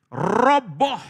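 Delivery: loud, high shout with rolled r; explosive dramatized cry; dramatic exclamation. Acted. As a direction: boom it out like a crash. Dramatizes the tower collapsing.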